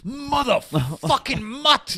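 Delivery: slow, high mock yell breaking into a laugh; hammed-up angry snarl through a laugh; Pesci's yell. Quoting Pesci insulting Billy Bats, slow mock yell. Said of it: "Pesci would goes".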